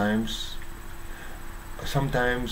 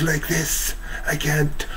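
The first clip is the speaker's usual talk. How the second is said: higher, hoarse strained demo; overstrained voice; 4 semitones higher than usual. Shown as a demonstration. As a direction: push the words through a worn-out throat. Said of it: "so overstrained".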